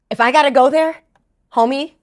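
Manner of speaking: usual speaking voice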